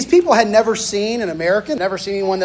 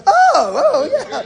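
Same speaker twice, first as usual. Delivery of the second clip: high, stunned oh oh yeah; the penny drops, caught off guard; startled realization; 4 dB louder, much slower than usual. Retold or imitated. Father-in-law realizing he gave permission, startled and high. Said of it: "And he goes".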